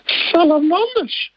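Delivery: very high, slow, squeaky snake exclamation; a scared snake blurting it out; high squeaky snake in a fright; frightened snake voice. Acted. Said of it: "Echt fantastisch met al die stemmetjes".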